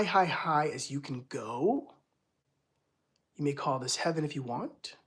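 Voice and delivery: calm guided imagery; guided visualization. Acted. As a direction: lead them up into the stars softly.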